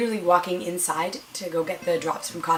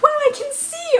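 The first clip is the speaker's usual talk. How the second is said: very high, loud amazed cry; excited; 5 dB louder than usual. Retold or imitated. Patient regaining sight after the eye drops, excited. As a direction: exclaim it high and loud, thrilled. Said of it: "I was so excited".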